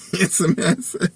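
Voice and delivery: real laugh through the words, pitched high. Real, not acted. Son telling how daddy misses him, laughing. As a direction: let a chuckle lift the voice right up.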